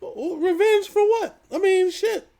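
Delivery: high-pitched, drawn-out disbelief; squeaky, incredulous comeback; incredulous scoff. Real, not acted. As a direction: question it way up high, amused. Scoffs at the revenge idea.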